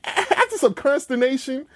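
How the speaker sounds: high, laughing grudging concession. Retold or imitated. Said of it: "you're like".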